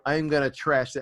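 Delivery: ordinary talk, nothing marked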